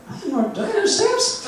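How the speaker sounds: high, fast, startled self-doubt; mock alarm. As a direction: squeak it out in sudden panic.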